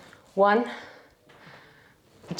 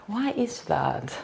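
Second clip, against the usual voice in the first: normal talking voice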